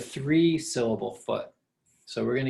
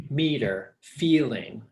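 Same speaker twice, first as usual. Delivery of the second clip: "Meter, feeling", each falling at the end; stress on first syllable; slower than usual. Shown as a demonstration. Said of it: "goes down at the end of the word".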